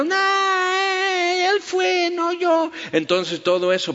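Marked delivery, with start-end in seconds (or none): 0.0-2.7 put-on high, crying shriek of a scared child; frantic, tearful child's scream. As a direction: squeal the excuse like a kid about to be hit. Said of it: "Y el niño gritando".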